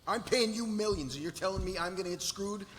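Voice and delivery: high-pitched angry complaint; yelling. Acted. Client berating lawyer over millions paid, yelling. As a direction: protest indignantly, pitched well up. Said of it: "Gerald Tate's yelling at Jessica".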